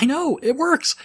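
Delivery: high-pitched retold excitement; delighted agreement. Telling a coworker the accent works, mock-excited squeal. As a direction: jump way up in pitch, thrilled.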